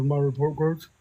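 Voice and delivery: sheepish mumble under the breath; mumbled quietly. Retold or imitated. Teen mumbling to his dad about the report cards, quietly. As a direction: mumble it low and soft, eyes down. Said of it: "And I said it like that".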